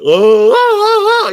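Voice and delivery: imitating the yell the nurses heard from his room; Tarzan yell. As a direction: bellow it like Tarzan swinging through trees. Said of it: "that Tarzan yell".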